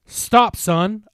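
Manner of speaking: high, loud stern order; commanding shout. A narrator's reading. Chief Slater holds Mark back, stern shout. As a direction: stop him hard. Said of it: "a voice said".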